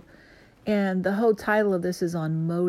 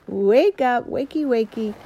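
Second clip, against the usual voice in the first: higher, louder mock wake-up call; playful louder wakey wakey; 4 semitones higher, 7 dB louder than usual. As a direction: rouse someone who is dozing.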